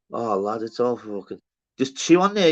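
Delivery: usual speaking voice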